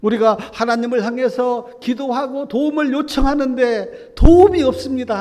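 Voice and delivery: high, loud anguished plea; crying out to God. Acted. 예레미야가 하나님께 울부짖으며 높고 크게 탄원. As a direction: cry out for help that never comes. Jeremiah's lament voiced in sermon.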